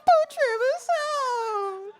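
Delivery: very high, drawn-out mock sobbing wail; comic tearful howl, stretched slow; hysterical wail. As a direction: wail it out, hammed-up and inconsolable. Too upset to speak, acts wailing.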